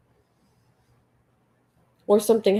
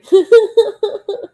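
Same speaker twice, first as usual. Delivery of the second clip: high, loud giggle; real high-pitched laughing fit; amused laugh; 12 semitones higher, 9 dB louder than usual. Real, not acted. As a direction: burst out laughing at your own mistake. Laughs at her own misreading.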